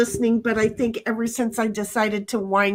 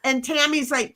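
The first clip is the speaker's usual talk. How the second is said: ordinary talk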